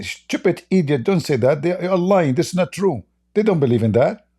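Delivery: mock angry viewer.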